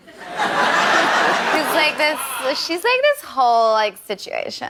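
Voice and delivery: put-on airy Californian Valley girl drawl; Valley girl accent. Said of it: "Callie from the Valley".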